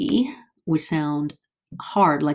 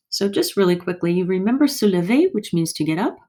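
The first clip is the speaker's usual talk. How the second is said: quick recap; 2 semitones higher, faster than usual. Real, not acted.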